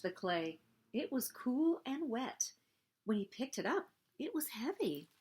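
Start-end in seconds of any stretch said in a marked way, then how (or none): none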